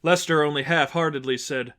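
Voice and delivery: plain reading voice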